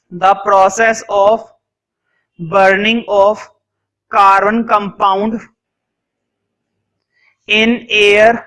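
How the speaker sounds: very slow, word by word with long pauses; slow dictation. Shown as a demonstration. Teacher dictating a definition for notes, very slow. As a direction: dictate each word so students can write. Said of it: "dictation continues".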